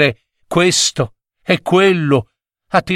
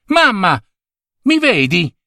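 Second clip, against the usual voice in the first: put-on high-pitched, eager child; hopeful call; 6 semitones higher than usual. A narrator's reading. Boy realising mother sees him, hopeful call. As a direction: call up to mother in happy surprise.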